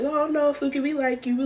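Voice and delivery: squeaky high pleading denial, playing the whole group; high mock reassurance. Other groups answering Suki, in a high mock-pleading voice.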